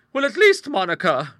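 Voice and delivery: high, loud prim spinster; prim old lady voice. Acted. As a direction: speak as a proper elderly lady. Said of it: "remarked Bertha".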